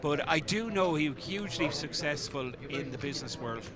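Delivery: ordinary talk, nothing marked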